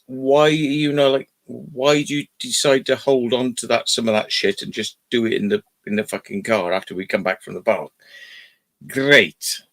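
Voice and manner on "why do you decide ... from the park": ordinary delivery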